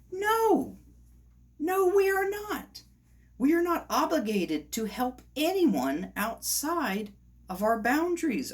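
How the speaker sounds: high, loud, indignant refusal; worked up. Real, not acted. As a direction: push back hard, pitch soaring with exasperation. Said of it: "it gets to me".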